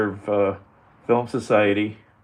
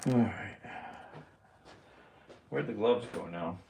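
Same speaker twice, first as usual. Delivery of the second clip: usual speaking voice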